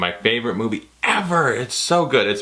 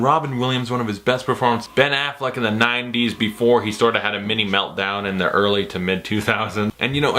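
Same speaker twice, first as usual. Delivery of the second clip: ordinary delivery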